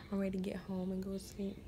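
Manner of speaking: whisper. Real, not acted. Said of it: "whisper heard".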